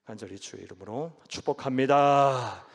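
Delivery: loud preached blessing. Real, not acted. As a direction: pronounce the blessing with full force. (힘차게 축복 선포)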